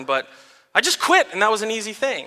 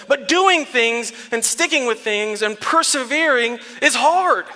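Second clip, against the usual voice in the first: usual speaking voice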